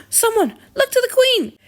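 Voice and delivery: panicked call for help; alarmed cry. Acted. Queen collapses, alarm raised.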